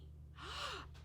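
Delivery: sharp, high gasp of dismay. Acted. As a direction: gasp in childish alarm. Girl gasps at the thought.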